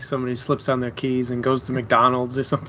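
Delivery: ordinary delivery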